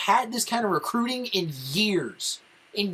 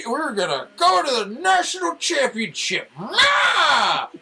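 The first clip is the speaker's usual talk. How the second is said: high, loud shout ending in a yell; shouting rant; 7 semitones higher, 5 dB louder, slower than usual. Real, not acted. Fan mocking title hopes, loud shout. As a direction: blow up at the fans. Ranting at fans.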